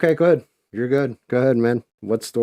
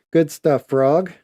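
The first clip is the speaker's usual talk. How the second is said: louder, higher cheer for Frog; excited cheer; 7 semitones higher, 7 dB louder, slower than usual. Real, not acted. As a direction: call it out, pumped up.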